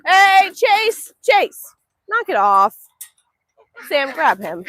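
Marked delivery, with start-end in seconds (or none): 0.1-2.8 loud raised call to the dog. Real, not acted. Owner calling dog Chase away from geese, loud call. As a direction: call out sharply across the yard.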